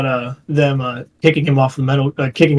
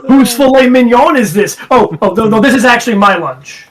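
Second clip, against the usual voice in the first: mock indignant boss; 5 semitones higher, 4 dB louder than usual. Acting the boss eating lunches.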